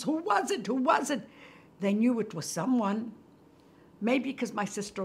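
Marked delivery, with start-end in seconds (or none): 0.0-1.3 imitating excited questioning, pitched up; animated women. Narrator translating what the women were saying, animated. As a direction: repeat it urgently, as the women did.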